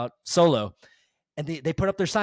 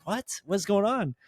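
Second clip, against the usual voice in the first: mock-baffled, pitched higher; retold bafflement; 6 semitones higher than usual. Telling co-host his reaction to what he watched, baffled. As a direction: toss it out as a puzzled reaction.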